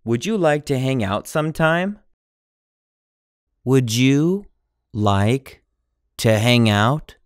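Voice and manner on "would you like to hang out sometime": normal talking voice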